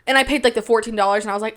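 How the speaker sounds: ordinary talk, nothing marked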